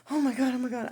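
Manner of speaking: loud retold shock; startled exclamation. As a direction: mimic a shocked man blurting out. Startled masseur after falling off bed.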